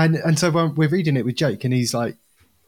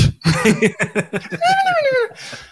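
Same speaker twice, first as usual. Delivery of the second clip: squeaky laugh. Acted. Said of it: "laughing in alien voice".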